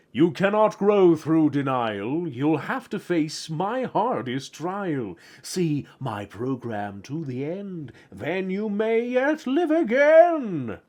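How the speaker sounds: slow, portentous oracle voice; solemn axolotl. Acted. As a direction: hand down a drawn-out verdict. Voices axolotl's solemn reply in poem.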